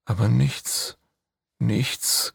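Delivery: ordinary delivery, nothing marked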